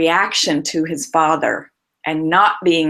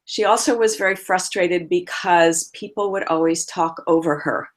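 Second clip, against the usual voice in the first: ordinary talk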